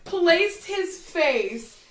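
very slow, drawn-out build; slow emphasis. Real, not acted. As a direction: stretch every word for drama. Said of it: "slow dramatic emphasis".

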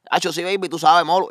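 lightly voiced cool street talk; Lugar's slang. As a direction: imitate a cocky friend's slang. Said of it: "Lugar habla así".

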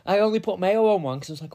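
normal talking voice

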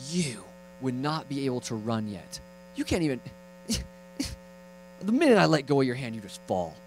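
hammed-up fed-up dad; mock angry father. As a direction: scold in mock disgust. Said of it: "God's not like an angry father saying".

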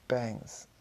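ordinary delivery, nothing marked

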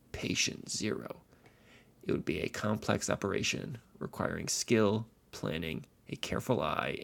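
ordinary delivery

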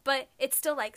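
ordinary talk, nothing marked

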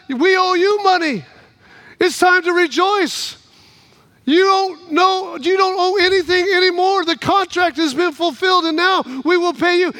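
joyful announcement. Acted. Insurance man announces good news.

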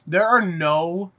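normal talking voice

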